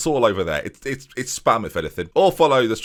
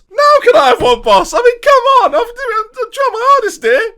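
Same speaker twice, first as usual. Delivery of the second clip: very high, loud squeaky begging; very high pleading player voice; 12 dB louder than usual. Acted. Striker demands a shirt after scoring, very high loud begging. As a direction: beg the boss shrilly for a shirt.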